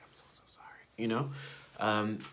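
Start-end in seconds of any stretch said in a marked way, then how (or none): 0.0-0.8 soft breathy whisper, almost no voice; whispered apology. Retold or imitated. Boy whispering an apology to the cashier, hushed. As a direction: whisper sorry to the cashier behind dad's back.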